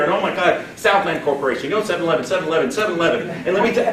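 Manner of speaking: hammed-up, rapid-fire recruiter gushing; over-eager pitch. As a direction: rattle it off breathlessly keen, mocking an overexcited seller.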